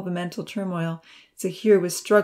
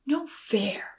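whispered complaint; 5 semitones higher, 5 dB quieter than usual. A narrator's reading. Children whispering outside the cave.

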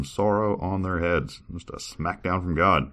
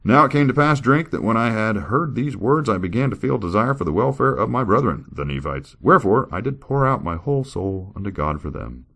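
higher, louder formal reading voice; scripture reading; 6 semitones higher, 5 dB louder than usual. Acted. Reader performing a scripture passage for viewers, formal. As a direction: read it like solemn old scripture.

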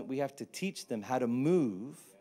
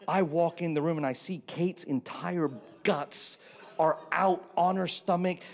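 ordinary delivery

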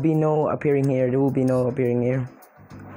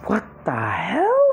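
slow, rising, high-pitched question; astonished, squeaky rise on "hell"; incredulous shock; 5 semitones higher than usual. Real, not acted. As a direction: stretch it out and swoop up at the end. Astonished by what happened in game.

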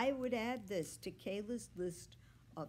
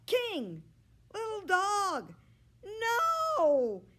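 higher and louder, rising to a cry; yelling; 13 semitones higher, 11 dB louder, much slower than usual. A narrator's reading. Kayla yelling at the dogs jumping overboard, alarmed yell. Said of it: "Kayla yells".

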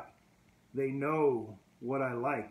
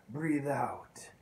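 whispered exhale. Retold or imitated. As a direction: breathe out breathed as a whisper. Breathing instruction.

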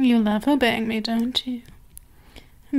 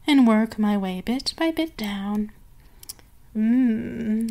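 sultry mistress. Acted. Mistress to her purring pet, sultry and teasing.

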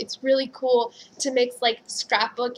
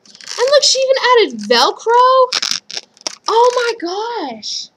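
higher, louder, slow drawn-out delight; thrilled; 9 semitones higher, 6 dB louder, slower than usual. Real, not acted. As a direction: gush over the detail. Finds Velcro in the purse.

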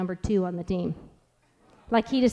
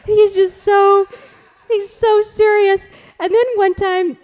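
high, laughing speech; laughing through words; 12 semitones higher, 9 dB louder, slower than usual. Real, not acted. Mother laughs telling about her serious son. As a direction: let the laughter spill into the words.

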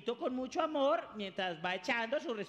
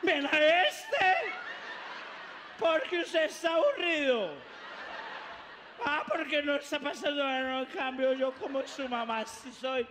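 put-on drunk mother; 3 semitones higher than usual. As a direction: demand the song like a tipsy mom.

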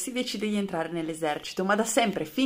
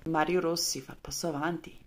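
ordinary talk, nothing marked

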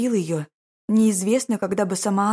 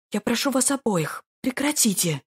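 higher, pleading and scared; frightened plea; 6 semitones higher than usual. A narrator's reading. Frightened lady begs brother and duke, pleading high. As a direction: beg them both to stop. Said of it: "взмолилась напуганная происходящим леди".

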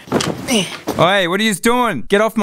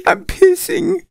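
high squeal through helpless laughter; laughing to tears. Real, not acted. Apologising to friends for pissing himself, laughing helplessly.

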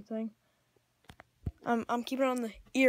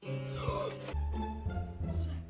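frightened; much slower than usual. Real, not acted. Startled by noise in closet.

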